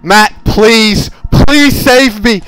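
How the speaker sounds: loud, high, slow mock plea; hammed-up pleading. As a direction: overplay a desperate beg. Begging teammate while caught.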